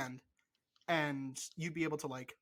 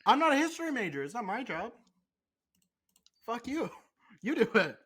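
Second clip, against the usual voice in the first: mock indignant; 5 semitones higher, 4 dB louder than usual. Real, not acted.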